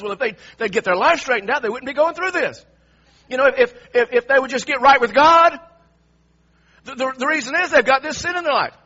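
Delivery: mock a self-righteous friend blaming the sufferer; judgmental friend. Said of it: "You've heard them say something along those lines".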